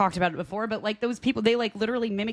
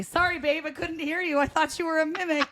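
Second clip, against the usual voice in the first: mock husband; 7 semitones higher than usual. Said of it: "my husband's gonna use that against me".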